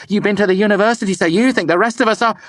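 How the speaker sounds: fast, high, loud resentment; angry. A narrator's reading. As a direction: hurl it at him. Denna angry at Kvothe.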